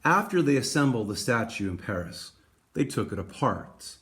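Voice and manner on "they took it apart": ordinary delivery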